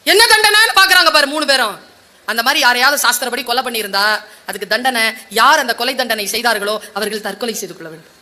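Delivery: normal talking voice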